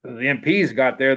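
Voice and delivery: normal talking voice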